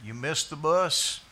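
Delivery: ordinary delivery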